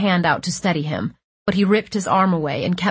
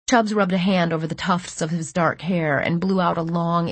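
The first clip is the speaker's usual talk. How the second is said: plain reading voice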